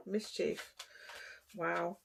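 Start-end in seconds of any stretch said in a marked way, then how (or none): none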